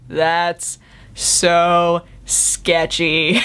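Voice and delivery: very slow, dragged-out so sketchy; drawn out judgment. Real, not acted. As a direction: stretch it in disgust. Reacting to creepy ad.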